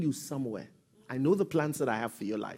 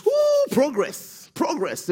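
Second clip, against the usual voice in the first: mock delight; 10 semitones higher, 5 dB louder, much slower than usual. Mocking imagined believer's joy.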